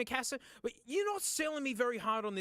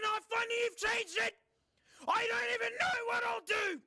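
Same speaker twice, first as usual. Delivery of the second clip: mock desperate shout; 12 semitones higher than usual. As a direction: shout a frantic over-the-top plea. Pleads with corporate not to change.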